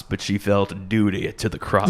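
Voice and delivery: low, solemn mock country balladeer. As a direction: deliver it as a dark country lyric. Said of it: "sounds exactly like [name]".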